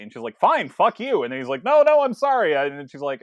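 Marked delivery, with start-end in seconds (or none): 0.4-1.2 higher, louder retort; mock angry outburst. Acted film lovers' quarrel. 1.6-2.5 loud, high-pitched pleading; frantic mock apology. Acted boyfriend backpedals in quarrel.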